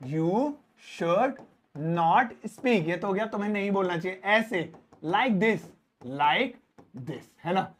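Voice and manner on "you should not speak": "you should not speak" word by word; slow, separated teacher read; very slow dictation. Shown as a demonstration. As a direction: spell it out slowly. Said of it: "translation demo".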